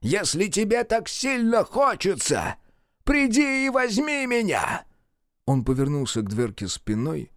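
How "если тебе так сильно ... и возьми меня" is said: высокий медленный вызов; с отчаянной дерзостью. A narrator's reading. Мерл бросает вызов демону. (Мерл вызывает демона, высоко и медленно)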